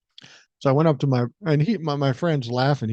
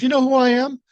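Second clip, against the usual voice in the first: louder, high-pitched self-important challenge; retold indignation; 11 semitones higher, 6 dB louder, faster than usual. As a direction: pull rank, imitating an affronted big shot. Retold angry customer pulling rank.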